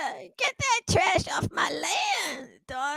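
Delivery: put-on high, slow old-timer calling his daughter; soft, strained high holler; drawn-out backwoods father voice; Walter Brennan. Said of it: "So this is Walter Brennan".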